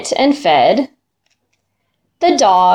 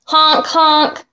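honking like a goose, a bit higher; 5 semitones higher than usual. Acted. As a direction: honk it out like a goose. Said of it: "said the goose".

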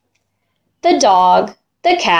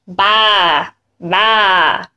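sheep bleat; 3 semitones lower than usual. Acted. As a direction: lower bleating baa. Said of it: "said the sheep".

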